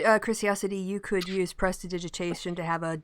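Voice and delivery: ordinary delivery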